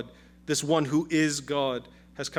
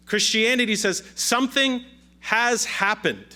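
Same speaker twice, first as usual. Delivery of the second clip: higher, louder pulpit proclamation; full-force preaching; 4 semitones higher, 4 dB louder than usual. Acted. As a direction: proclaim it to the whole congregation.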